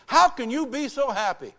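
high, loud challenge; incredulous banker. Retold or imitated. Banker challenges a cheerful preacher, loud incredulity.

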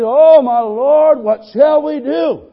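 high, slow, loud terrified cry; frightened young servant crying out; panicked servant. Acted. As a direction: wail it like a scared servant. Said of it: "He said".

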